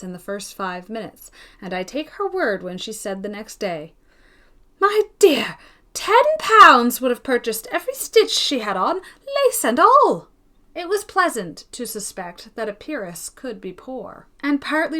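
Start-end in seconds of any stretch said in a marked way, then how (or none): 4.8-10.3 high, loud gossiping spinster; gossiping old lady. Acted. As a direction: exclaim it like a scandalised old lady. Miss Pole's gossiping quote.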